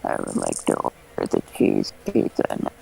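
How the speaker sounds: deep whispered robot voice. Acted. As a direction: order like a flat machine. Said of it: "my robot voice".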